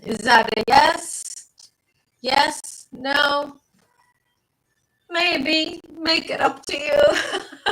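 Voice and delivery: playfully silly, stretched words; silly presentation. Pretend. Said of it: "presenting it in a very silly and stupid way".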